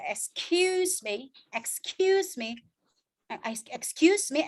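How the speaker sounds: "excuse me" slowed, the U held long; long U sound. Shown as a demonstration. Teacher modelling excuse me for students, long slow U. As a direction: draw out the U in "excuse me". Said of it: "Itu harus dibaca panjang".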